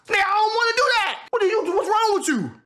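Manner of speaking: high-pitched exasperated outburst; indignant protest. Real, not acted. Telling critics he won't be touched, high indignant protest. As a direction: push back hard, voice pitched right up. Heated rant at imagined critics.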